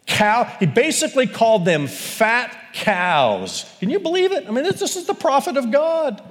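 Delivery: very high, astonished; can you believe it, voice leaping up; amazed disbelief. Real, not acted. Preacher marveling to the congregation, high and astonished.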